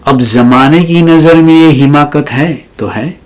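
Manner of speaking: mushaira-style verse reading; poetry recitation. Acted. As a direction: recite it lifted, then land the refrain. Recites a ghazal as poem.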